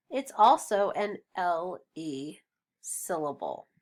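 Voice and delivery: ordinary talk, nothing marked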